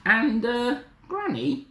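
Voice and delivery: put-on high, hesitant little girl; careful Little Red. Little Red questioning Granny, high careful voice. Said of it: "Little Red said carefully".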